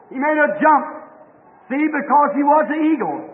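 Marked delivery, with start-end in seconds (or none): none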